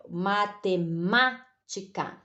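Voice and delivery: "matemática" very slow, broken into syllables, MÁ held and stressed; ênfase na sílaba tônica. Shown as a demonstration. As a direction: spell out "matemática" by syllables, weight on MÁ. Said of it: "nós pronunciamos com mais força". ("matemática" bem devagar, força no segundo MA)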